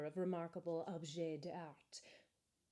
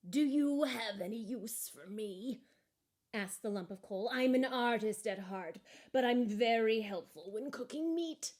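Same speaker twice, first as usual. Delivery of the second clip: hopeful lump of coal; 2 semitones higher, 4 dB louder than usual. Acted. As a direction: offer itself, eager to help.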